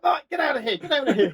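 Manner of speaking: loud, high urgent shout to flee; urgent order. Retold or imitated. Commander yelling at his driver to flee, loud and urgent. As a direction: bark it out, get us out now.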